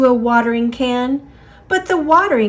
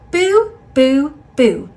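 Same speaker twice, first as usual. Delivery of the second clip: playful boos; 4 semitones higher, 4 dB louder than usual. Acted. Plant scaring bees.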